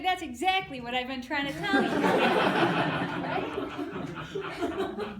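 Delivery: punchline said through laughter; laughing. Real, not acted. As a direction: let the laugh break through the line. Punchline delivered while laughing.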